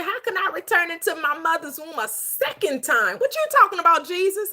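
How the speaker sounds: high-pitched, baffled questioner; Nicodemus. Retold or imitated. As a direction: act out an incredulous comeback, pitched well up. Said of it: "What did Nicodemus say?".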